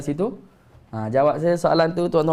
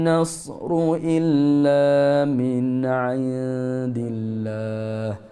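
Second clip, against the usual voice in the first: Quranic recitation; 3 semitones lower, much slower than usual. Shown as a demonstration. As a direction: intone slowly, dropping at the end. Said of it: "teacher recites verse".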